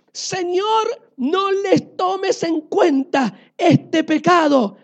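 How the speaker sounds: loud, high, slow cry for mercy; shouted plea. Acted. Stephen on his knees to the Lord, a loud pleading cry. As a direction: beg mercy for them at full voice. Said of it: "cayó de rodillas y gritó".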